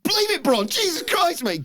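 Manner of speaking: very high, loud disbelief; mock stunned crowd. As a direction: cry it out high in shock. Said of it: "everyone's like".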